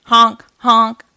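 loud goose honks; punchy imitation of geese; honking geese. A narrator's reading. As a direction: honk like a flock overhead. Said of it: "said the geese".

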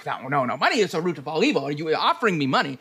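high, fast, suspicious character voice; suspicious toll collector. Acted. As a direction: play the wary toll collector, hurried and high.

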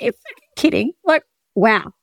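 mock shock at the price; retold disbelief. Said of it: "I was like".

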